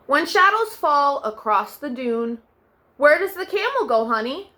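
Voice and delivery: put-on gentle storybook narration for children; bedtime story reading. A narrator's reading. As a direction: read it like tucking kids in at night. Reads second picture book aloud.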